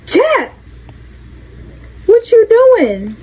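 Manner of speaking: high, slow, surprised call-out; startled call. Real, not acted. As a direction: call out in shock at finding him. Startled to find Cat.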